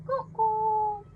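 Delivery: high, loud bird call; high silly bird call; cuckoo call. Pretend. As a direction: sing out a loud playful cuckoo. Animal sounds for baby.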